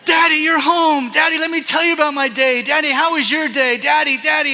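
put-on high, loud, clamoring child voice; excited kids. Children mobbing dad as he gets home, excited mock shouting. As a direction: imitate children competing for dad's attention.

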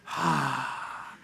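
a long breathy sigh of relief; relieved sigh. Pretend. Introvert alone at last, sighing with relief. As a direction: exhale like finally alone.

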